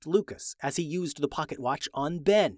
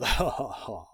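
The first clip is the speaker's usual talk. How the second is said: fading evil chuckle; 4 semitones lower, 4 dB quieter than usual. Acted. King's laugh fading out.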